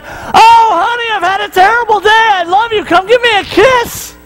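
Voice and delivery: high, hammed-up whiny sweetness; mock sweet spouse. Pretend husband greeting his wife, sugary and high. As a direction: overdo the tender greeting. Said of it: "you don't come home and be like".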